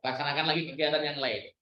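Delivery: ordinary talk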